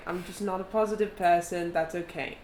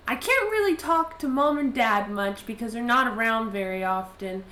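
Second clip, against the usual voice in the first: put-on higher, louder girlish pleading; lonely girl's letter voice; 4 semitones higher, 4 dB louder than usual. Girl writing to absent friend, higher pleading voice. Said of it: "she says".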